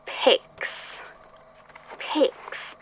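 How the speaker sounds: whispered name while searching. Acted. Archivist alone among files, whispering a name to himself. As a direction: breathe the name under your breath.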